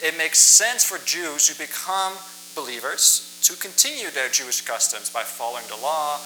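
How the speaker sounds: normal talking voice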